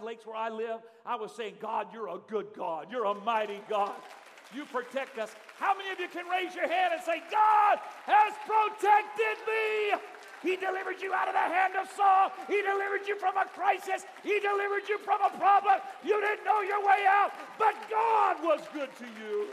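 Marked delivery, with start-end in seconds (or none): 1.6-5.3 slow, loud, fervent thanks to God; loud praise prayer. Acted. Father thanking God his baby is safe, fervent praise. 5.6-19.5 full-force preaching. Acted. Preacher rousing the congregation, shouting praise.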